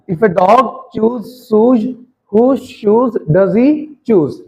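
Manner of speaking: very slow, every word spaced out; teacher reading it slowly for the class to copy; slow demo. Shown as a demonstration. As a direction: model the tongue twister word by word. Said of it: "आपको बोल के बता रहा हूँ".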